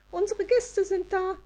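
high elf singing out cheerily; forced cheer. A narrator's reading. Elf announces guests to grumpy friends, forced high cheer. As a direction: announce it with strained jollity, high-pitched. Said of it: "verkündete Elida bemüht fröhlich".

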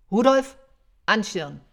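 loud, high summoning shout; calling out. Acted. Santa ordering his reindeer to harness up, shouting. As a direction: call across the yard, then command.

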